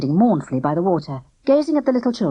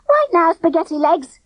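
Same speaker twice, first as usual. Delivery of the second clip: high, bright teasing tone; cheerful; 12 semitones higher, 5 dB louder than usual. A narrator's reading. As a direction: tease him cheerfully. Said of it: "she said cheerfully".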